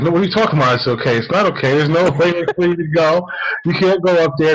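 half-laughing retold protest; urgent insisting. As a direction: stop him, he can't go up there. [name] insists the man can't go up.

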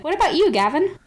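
ordinary talk

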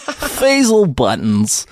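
very slow, high, savouring each syllable; grand showbiz name. Pretend. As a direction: roll the name out like an awards host. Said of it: "repeats the name grandly".

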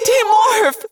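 very high, loud desperate shout; scream. A narrator's reading. Cassie screams to demorph despite warning, desperate scream. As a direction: scream it as a last command. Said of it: "I screamed again".

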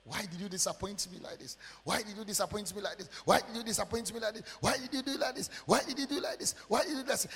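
insistent questioning, pitch climbing with each repeat; acted desperate protest to God; pleading complaint. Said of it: "was asking God".

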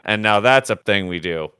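ordinary talk, nothing marked

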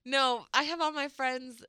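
normal talking voice

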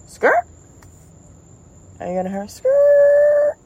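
very high squeal of tires; tire screech. Retold or imitated. Driver warning others, a high tire-screech squeal.

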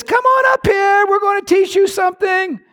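enemy taunt. Acted.

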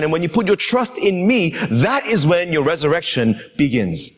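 impassioned preaching; full-force preaching. Acted. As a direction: proclaim it to the whole church.

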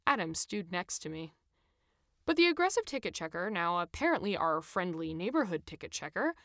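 ordinary delivery

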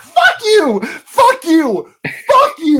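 shrill, loud repeated fuck you; swearing tirade. Pretend. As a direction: scream it like a tinny toy. Mock Quagmire patch shouting.

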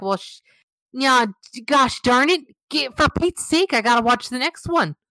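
high, folksy mock exasperation; hooked mom sighing gosh darn it; Midwest mom voice. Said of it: "I gotta stop 'cause I can't now".

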